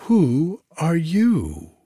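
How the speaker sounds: stretched-out astonished echo; echoed cry. A narrator's reading. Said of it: "echoed all the courtiers".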